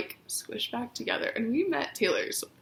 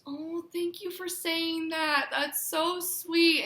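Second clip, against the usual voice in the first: higher, slow, gentle thanks; imitating Taylor's slow, sweet thanks; sweet, touched; 7 semitones higher, much slower than usual. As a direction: melt a little as you say it. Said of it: "She goes".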